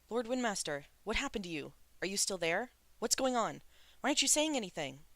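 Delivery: rising alarm, voice climbing and quickening at the end; anxious calling. A narrator's reading. Shulian calling anxiously, no answer.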